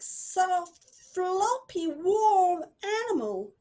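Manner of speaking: usual speaking voice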